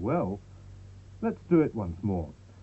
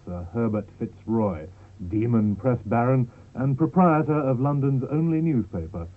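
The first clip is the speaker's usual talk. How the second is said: slow, louder melodramatic villain build-up; dramatic narration; 4 dB louder than usual. A narrator's reading. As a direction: build the menace like a panto narrator.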